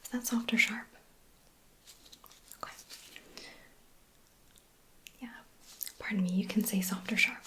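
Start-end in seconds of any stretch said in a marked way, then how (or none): none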